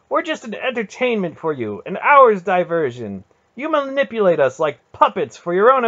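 very high, loud furious tirade; runaway anger. A narrator's reading. Garion turning on his aunt, runaway fury. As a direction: let the words rush out in uncontrolled anger. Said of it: "his anger had finally run away with him".

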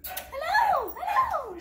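high shouted hello hello; excited greeting. Real, not acted. Owner squealing hello to the excited dog.

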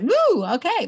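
high, loud mock whoop then settle; excited whoop. Guest telling how prayer felt like a power drill, excited whoop. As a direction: act out a sudden jolt of power.

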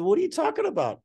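high-pitched mock disbelief; incredulous question. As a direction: blurt it out, voice shooting up. Baffled reply, asked once more.

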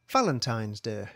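usual speaking voice

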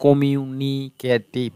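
কমিউনিকেটিভ measured out part by part; split into syllables. Shown as a demonstration. Teacher returning to an earlier example, কমিউনিকেটিভ said syllable by syllable. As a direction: break কমিউনিকেটিভ into কমিউ, নি, কে, টিভ. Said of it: "এখানে চারটা শব্দাংশ".

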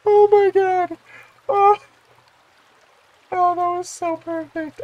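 very high squealing delight; real overjoyed squeal; freaking out. Real, not acted. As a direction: squeak it out, overcome with joy. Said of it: "I'm just freaking out right now".

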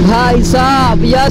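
slow drawn-out भाई साहब यार ending on a rising यार; catchphrase, rising. Shown as a demonstration. Vlogger repeating his famous line, slow with a rising finish. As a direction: stretch it and lift the last यार. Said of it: "मेरा ये dialogue ना भाई बहुत ज़्यादा famous हुआ था".

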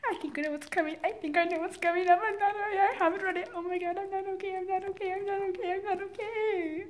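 gasping at the twist; excited panic. Real, not acted. Reacting to a book twist.

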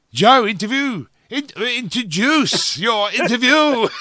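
loud, high mock-grand announcer call; big showy intro, stretched out and playful; hyped-up introduction. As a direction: boom it out like a ringmaster, half laughing. Host hypes up interview introduction.

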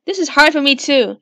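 normal talking voice

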